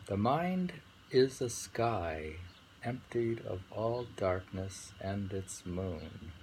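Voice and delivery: slow, measured recital, pausing between lines; poem reading. Acted. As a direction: announce and read the verse slowly. Reads a Zen poem aloud.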